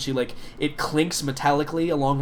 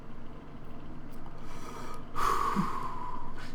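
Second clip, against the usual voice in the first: long, unvoiced smoky breath out; smoker's exhale; 9 dB quieter than usual. Gretch smoking while Boomer talks, long exhale. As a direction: blow out smoke slowly. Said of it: "She's just smoking her Eho stick".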